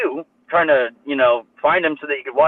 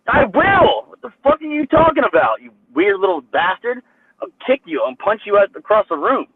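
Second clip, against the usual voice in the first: retold angry yell; 6 semitones higher than usual. Retelling yelling at the staff guy, furious. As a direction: shout back, threatening the little guy. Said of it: "And I'm like".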